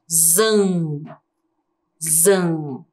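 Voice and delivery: "Zan" alone with a long buzzing z, repeated; isolated syllable. Shown as a demonstration. Teacher answers about the second syllable, "Zan" sounded out slowly. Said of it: "Como que ficou a segunda sílaba?".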